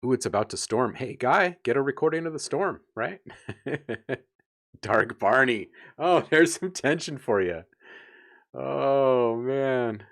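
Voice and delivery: loud, high, manic rambling; wild unhinged ranting. Real, not acted. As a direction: rant it out wildly, giddy. Hyped rant over storm sounds.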